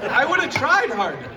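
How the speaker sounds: high, loud comic exclamation; mock regret. Real, not acted. Speaker joking about lawyer dad's naps, mock regret. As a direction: burst out with exaggerated regret.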